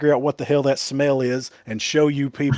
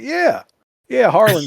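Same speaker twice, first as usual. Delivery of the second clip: eager reply; 8 semitones higher, 6 dB louder than usual. Retold or imitated. Retelling his eager reply to Caleb.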